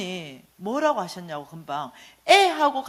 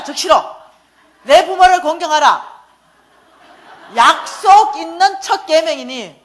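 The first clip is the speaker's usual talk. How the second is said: louder, higher, each key word hit hard, the verse slowed down; loud preaching; 5 semitones higher, 8 dB louder than usual. Retold or imitated. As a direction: hammer the commandment home. Mother preaches verse at son.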